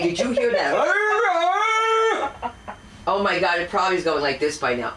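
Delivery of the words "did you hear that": high, very slow, loud; drawn-out exclamation. Real, not acted. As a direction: stretch it out in disbelief. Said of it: "mock outrage at noise".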